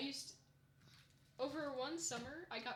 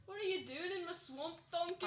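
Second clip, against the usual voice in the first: put-on higher ogre voice with Scottish accent; Shrek; 7 semitones higher, 4 dB louder than usual. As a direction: bellow it like a Scottish cartoon ogre. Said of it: "I also do the Shrek".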